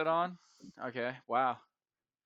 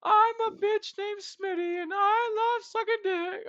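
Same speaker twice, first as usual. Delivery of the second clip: much higher, slow whiny mock voice; mocking whiny impression; 3 dB louder, slower than usual. Mocking his absent co-host as a whiny crybaby, high and slow. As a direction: whine it out like a pitiful crybaby. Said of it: "He's like".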